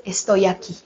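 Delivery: God's cry voiced softly, almost hushed; quiet answer. Preacher voicing God's reply to the girl, soft and hushed. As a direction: answer gently and low, close by. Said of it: "el grito de Dios es".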